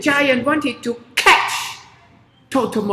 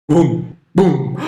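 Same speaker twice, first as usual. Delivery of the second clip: booming footsteps; 8 semitones lower, 5 dB louder than usual. Acted. Giant's footsteps.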